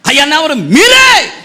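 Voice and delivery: a preachy prophet scolding, building to an accusing shout; self-styled prophet. Retold or imitated. False prophet accusing the officers, rising to a shout. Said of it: "అని ఏమి చెప్పడం మానేసి".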